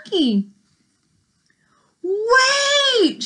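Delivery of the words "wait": high ringing cry of Wait!; shout. A narrator's reading. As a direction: call after him, shouting. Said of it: "she shouted".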